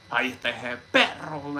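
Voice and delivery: mock telling-off in a put-on voice; put-on insulting outburst; imitated scolding. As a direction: imitate someone laying into you. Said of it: "me daba unas buenas reventadas".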